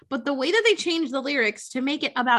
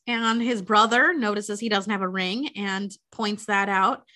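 ordinary talk, nothing marked

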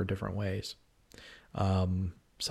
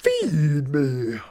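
Audrey II voice; 6 semitones higher, 4 dB louder than usual. Retold or imitated. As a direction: growl it like a hungry plant. Little Shop of Horrors quote.

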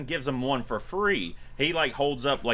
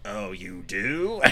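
low, slow, softer question; sly djinn; 4 semitones lower, 5 dB quieter, much slower than usual. Retold or imitated. Djinn catching Kane Hodder's wish, slyly intrigued. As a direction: draw it out, pouncing on the slip.

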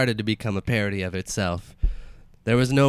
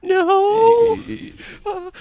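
high-pitched dead mother voice; 6 dB louder than usual. Acted. Dead mother character speaking.

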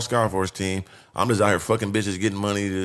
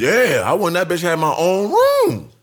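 high, animated brag with drawn-out words; gleeful boasting; 7 semitones higher, 3 dB louder than usual. Real, not acted. Telling host about getting his own room, gleeful boasting. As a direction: crow about it, pitched up and stretched out.

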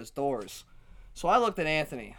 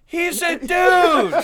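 high, loud, slow cry of disbelief; mock protest shout; 12 dB louder, much slower than usual. Her friend correcting the drunk woman, laughing shout. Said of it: "and the guy's like".

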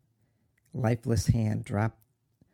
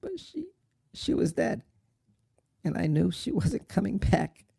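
high, softened voice choked with tears; tearful; 7 semitones higher than usual. Acted. Son admitting his mother is dead, tearful. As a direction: struggle to speak through grief.

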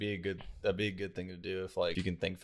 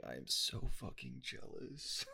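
quiet seething; 4 semitones higher, 10 dB quieter, slower than usual. Retold or imitated. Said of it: "and I was like".

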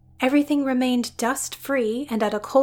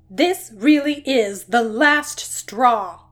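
slow, loud growl of fed-up anger; 6 dB louder, slower than usual. Acted. Doctor sizing up a dragon in her lab, slow angry growl. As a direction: growl it out, at the end of her rope. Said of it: "she growled".

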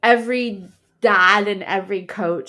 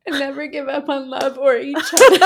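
laughing through it; 5 semitones higher, 4 dB louder than usual. Real, not acted. Reading sweet vow quote, breaking up.

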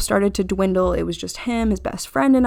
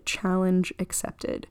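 slow, soft and dry, a quiet dare; understated sly "Challenge accepted"; deadpan acceptance; 8 dB quieter, slower than usual. Retold or imitated. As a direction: mutter it slowly like a sly challenge. Deadpan reply to his rules.

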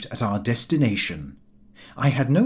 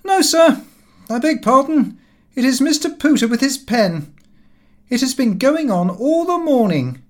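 high, loud, put-on clerk's voice; voiced clerk; 11 semitones higher, 6 dB louder than usual. A narrator's reading. Office clerk answering his master, high and loud. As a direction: pipe it up like a flustered office boy. Clerk's reply quoted in diary.

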